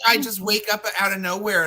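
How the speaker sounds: normal talking voice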